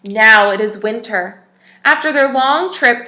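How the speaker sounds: clear, even storybook reading voice; story narration. Acted. Teacher reads a picture book to children, storybook narration. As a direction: read the tale aloud to little listeners.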